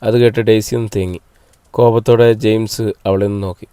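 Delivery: ordinary delivery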